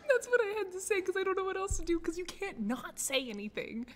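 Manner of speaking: high-pitched, helpless laughing protest; laughing wail. Real, not acted. Streamer explaining her embarrassing apology, laughing wail. Mortified laughter over language failure.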